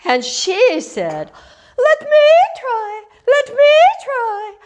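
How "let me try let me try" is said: very high, loud loon call; Lady Loon. A narrator's reading. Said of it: "Then Lady Loon came forward".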